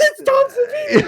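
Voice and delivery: high, loud fan squeal of recognition; excited outburst. Retold or imitated. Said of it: "I'm getting all excited".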